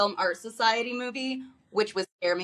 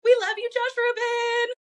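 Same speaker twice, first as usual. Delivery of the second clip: fan shout-out; 12 semitones higher than usual. Pretend. Fan calling out to Josh Rubin, excited high cheer. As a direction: call out adoringly in a high voice. Calls out to absent filmmaker.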